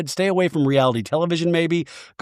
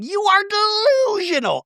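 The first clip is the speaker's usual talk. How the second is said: much higher mock-scornful jab; Shannon impression. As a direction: sneer it in a high put-on voice. Shannon insulting Katie.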